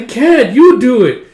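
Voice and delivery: put-on high, loud kid's whine; whiny child. Recapper voicing a child pushing the sewing away, whining high and loud. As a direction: whine like a stubborn little kid.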